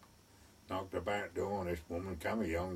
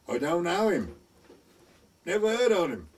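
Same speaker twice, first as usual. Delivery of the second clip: raised retort; 6 semitones higher, 6 dB louder than usual. Retold or imitated. Bloke retorts to lorry driver.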